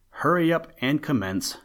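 a touch of fretful urging; worried. A narrator's reading. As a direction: hurry them along, mildly worried. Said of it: "said the worried old blackbird".

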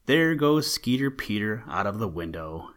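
ordinary delivery, nothing marked

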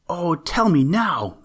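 the little pumpkin begging the sunbeams for the secret, eagerly; eager plea. Acted. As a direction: beg to be told right now. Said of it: "cried the little pumpkin".

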